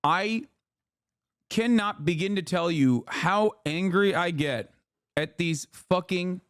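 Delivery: normal talking voice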